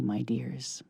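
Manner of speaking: plain reading voice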